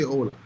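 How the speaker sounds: "hola" said as in plain English; "hola" without Spanish accent; English-style hola. Shown as a demonstration. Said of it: "the same way you speak in English".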